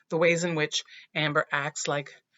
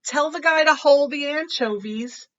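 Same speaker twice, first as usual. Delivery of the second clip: loud, higher call across the classroom; 9 semitones higher, 8 dB louder than usual. Acted. Amber calls out a joke to Justin, loud and high. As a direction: sing out the joke to the whole room. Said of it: "I call out".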